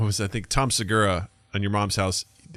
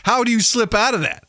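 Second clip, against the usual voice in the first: incredulous quote; 11 semitones higher than usual. Retold or imitated. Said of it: "He's like".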